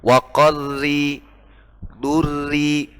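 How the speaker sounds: Wa qarri, durri with a light thin r after kasra; thin r in qarri and durri, slow; slow Quranic recitation with thin r. Shown as a demonstration. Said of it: "ketika ro tasydid itu berharokat kasrah, maka dibaca tipis".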